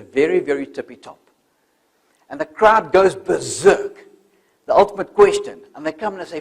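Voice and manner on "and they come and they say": ordinary talk, nothing marked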